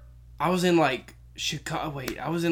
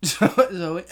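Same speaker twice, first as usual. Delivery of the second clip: usual speaking voice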